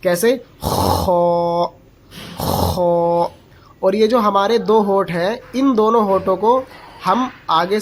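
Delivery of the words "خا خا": long خو opened by a rasping scrape like a snore; lion's growl sound. Shown as a demonstration. Teacher imitates a lion for the letter, rasping full خو. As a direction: rasp خو full-mouthed, lips rounded, like an angry lion. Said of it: "شیر کے غصے کی آواز نکالی جاتی ہے".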